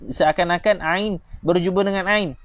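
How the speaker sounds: usual speaking voice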